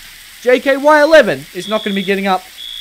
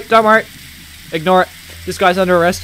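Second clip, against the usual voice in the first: ordinary talk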